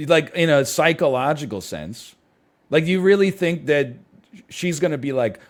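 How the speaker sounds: ordinary talk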